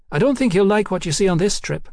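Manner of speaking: high-voiced cheeky London girl. A narrator's reading. Hetty warns Mallory.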